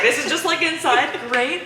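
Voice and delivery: deadpan disappointment breaking into laughter; laughing sarcasm. Retold or imitated. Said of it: "I was like".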